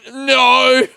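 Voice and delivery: high, loud whimpered no; pitiful no. Retold or imitated. Answering his mate, a high pitiful no. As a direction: admit it pitifully. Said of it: "And I'm like".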